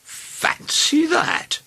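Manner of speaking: very slow, high, wondering murmur; musing king; thoughtful. A narrator's reading. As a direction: stretch the words out as the thought sinks in. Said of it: "said the king thoughtfully".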